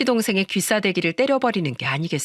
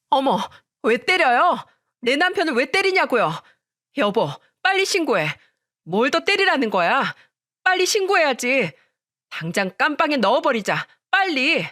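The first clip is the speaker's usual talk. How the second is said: higher-pitched indignant outcry; fussing, making a scene; 6 semitones higher than usual. A narrator's reading. Sister-in-law urges her husband to report the slap, frantic outrage. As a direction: raise a frantic fuss, urging action. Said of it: "동서의 호들갑을 보면서".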